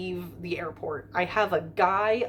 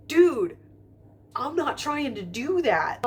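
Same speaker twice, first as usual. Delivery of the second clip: high, loud "dude", then high protest; mock-exasperated retort, starting loud; protesting reply; 7 semitones higher, 4 dB louder than usual. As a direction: act out an indignant comeback, pitched well up. Acting out her reply to guard.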